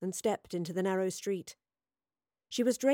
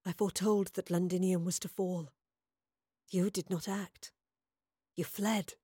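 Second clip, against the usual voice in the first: softer, measured, husky accusation; husky soothsayer; 4 dB quieter than usual. A narrator's reading. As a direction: accuse quietly, huskily, with gaps between phrases. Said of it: "the woman replied in a husky voice".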